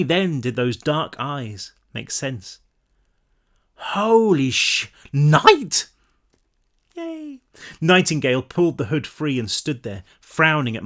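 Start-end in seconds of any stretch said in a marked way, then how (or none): 3.7-5.9 slow, stunned gasp of recognition; shocked recognition. Acted. Marlowe recognises the dead friend. 6.9-7.4 high, soft squeal of joy; little cheer. Real, not acted. Narrator cheering the reunion, high little yay.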